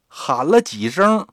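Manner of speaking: plain reading voice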